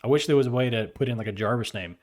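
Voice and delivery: ordinary talk, nothing marked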